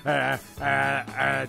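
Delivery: ordinary talk, nothing marked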